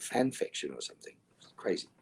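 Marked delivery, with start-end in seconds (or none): none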